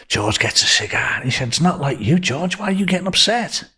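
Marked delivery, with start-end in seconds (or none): 1.5-3.7 higher-pitched, puzzled question, lightly acted; mild retold concern. Friend asks angry George what upsets him, mild retold concern.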